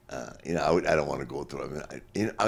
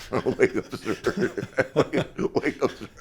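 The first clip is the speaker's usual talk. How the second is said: higher, louder, laughing through it; laughing wake-up call; 7 semitones higher, 3 dB louder, much slower than usual. Retold or imitated. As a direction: crack up while calling out. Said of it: "He's going".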